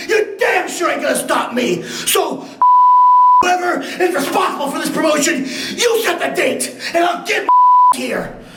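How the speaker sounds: angry shouting promo. Real, not acted. Wrestler ranting at Ricky Morton and the promotion, angry shouting. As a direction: rant at the rival, refusing to be stopped. Furious promo calling out rival.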